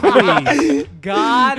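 loud, pleading cry to God; desperate prayer. Retold or imitated. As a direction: beg God out loud, half laughing at yourself. Desperate prayer before being cut.

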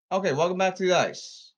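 ordinary talk, nothing marked

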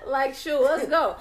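loud, high playful dare; mock bravado. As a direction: bring it on, half joking. Daring the whooping, mock bravado.

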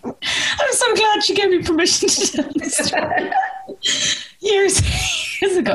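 very high, squeaky talk through real laughter; cracking up mid-sentence; helpless laughing. Real, not acted. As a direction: struggle to get the words out between laughs. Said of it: "I have to calm down because I laugh so much".

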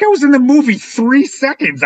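high-pitched retold disbelief; acted-out astonishment; incredulous. Acting out his disbelief.

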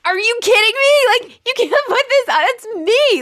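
high, loud, appalled cry; mortified outburst. Retold or imitated. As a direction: shriek it out in disbelief. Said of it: "I was like".

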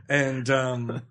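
ordinary delivery

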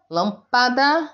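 teacher separating the last syllables of "lâmpada"; syllables picked out. Shown as a demonstration. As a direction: draw out "lâmpada" to point at "pada". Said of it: "o padá é átona".

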